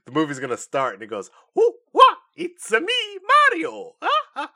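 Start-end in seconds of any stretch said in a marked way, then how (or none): 1.5-4.5 whooping, very high falsetto catchphrase; Mario. Acted. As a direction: bounce in like a cartoon game hero. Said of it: "like, doing, like, the regular".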